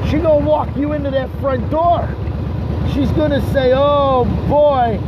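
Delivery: big declamatory trash talk; hyped promo. Acted. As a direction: work the crowd like a wrestling promo. High-energy promo addressed to Scotty.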